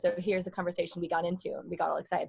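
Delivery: ordinary talk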